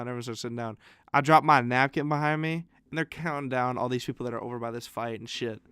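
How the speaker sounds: ordinary talk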